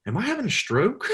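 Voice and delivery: high-pitched imitation of a baffled waitress; bewildered waitress. Retelling the waitress's confusion at being double-talked, high mock-bewildered voice.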